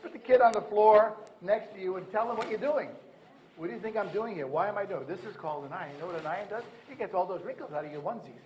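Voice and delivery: put-on lively parent talking a toddler through ironing; coaching parents. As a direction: urge the parent, then chat to the baby. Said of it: "what we say to parents is".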